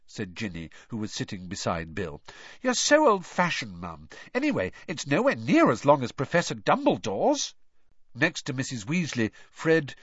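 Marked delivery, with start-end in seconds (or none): 2.6-7.5 put-on teasing girl voice. Ginny teasing her mum.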